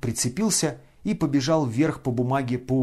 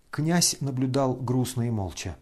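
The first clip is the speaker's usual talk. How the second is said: ordinary delivery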